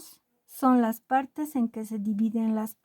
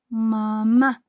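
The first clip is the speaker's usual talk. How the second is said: "mamá" with the final "má" punched louder; stress on last syllable; 3 dB louder than usual. Shown as a demonstration. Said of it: "Su sílaba tónica es la última". ("mamá" con golpe de voz en la última sílaba)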